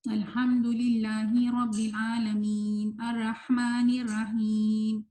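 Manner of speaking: "الحمدللہ رب العالمین الرحمن الرحیم" slow, every sound nasalised through the nose; wrong nasal everywhere. Shown as a demonstration. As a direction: demo of the wrong recitation with ghunna everywhere. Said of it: "ہر جگہ غنہ کا استعمال کر دیتے ہیں". (ہر جگہ غنہ کے ساتھ غلط قرات)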